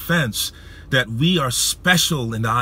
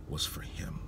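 very soft, low, awed hush; hushed revelation; 7 semitones lower, 14 dB quieter than usual. Retold or imitated. Preacher quoting God's personal message to him, hushed whisper. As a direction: drop to a near whisper.

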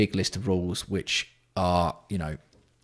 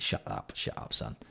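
whispered; 9 semitones lower, 11 dB quieter than usual. Real, not acted. Hushing a chat member.

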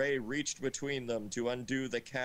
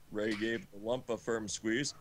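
normal talking voice

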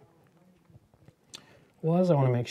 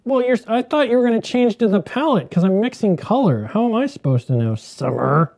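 higher, louder protest; loud complaint; 9 semitones higher, 7 dB louder than usual. Real, not acted. To Summer about the palette, a loud higher complaint. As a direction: complain loudly across the room.

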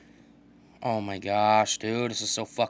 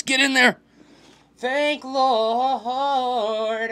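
much higher and louder, pure joy; elated scream; 10 semitones higher, 7 dB louder, much slower than usual. Real, not acted. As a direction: scream it as the ball goes in. Scoring the tying goal.

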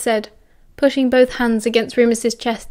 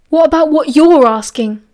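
louder, higher angry shout; 4 semitones higher, 6 dB louder than usual. Acted. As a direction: explode at him. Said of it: "Remus shouted".

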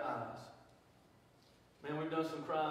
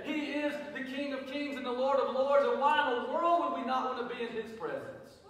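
higher, louder preaching that builds; full-force preaching; 6 semitones higher, 5 dB louder than usual. Real, not acted. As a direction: lift the crowd on each line.

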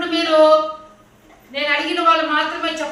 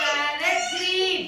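slow high model; 11 semitones higher, 3 dB quieter, slower than usual. Shown as a demonstration. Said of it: "చిలక పచ్చ చూడండి".

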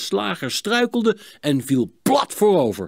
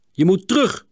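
higher, firm warning; warning order; 6 semitones higher, 4 dB louder than usual. A narrator's reading. Klinkhamer sends Hielke back to the line, warning. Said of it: "waarschuwde Klinkhamer".

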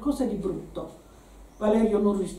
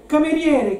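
raised, louder summons; calling out; 5 semitones higher, 5 dB louder than usual. A narrator's reading. Man summoning the waiter for a brandy, calling out. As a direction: call across to get service. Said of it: "chiamò".

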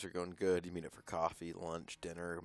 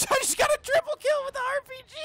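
high, fast triumphant yell; excited shout; 6 dB louder, faster than usual. Real, not acted. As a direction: scream the triple kill. Excited gaming brag.

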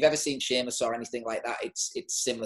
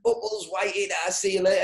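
higher, louder jeering lads; mocking mates; 6 semitones higher, 3 dB louder than usual. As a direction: send him off with a teasing laugh in the voice. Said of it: "everyone started laughing, going".

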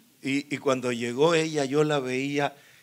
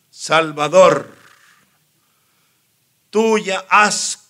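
put-on slow, loud, weighty advice from an old confessor; stern spiritual director; 4 semitones higher, 12 dB louder than usual. Spiritual director warning Salvador off priesthood, slow, loud and stern. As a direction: lean in and lay it down slowly, loud and firm. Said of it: "él hizo esto, me tocó así".

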